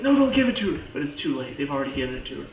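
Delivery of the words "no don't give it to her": high, loud acted plea; panicked shout. Coulson too late to stop the serum, imitated panicked shout. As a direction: cry out to stop them, pitched way up.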